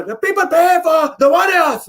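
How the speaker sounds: high-voiced mock fighter, mangling Warriors; Yoel Romero. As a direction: squeal it in a high, accented imitation. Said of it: "he can't say Warriors".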